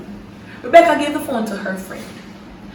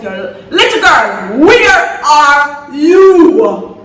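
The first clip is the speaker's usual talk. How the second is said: slow, loud, drawn-out demand; father going off; 2 semitones higher, 6 dB louder, much slower than usual. Retold or imitated. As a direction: stretch each word like an irate father. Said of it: "Rebecca's father went off".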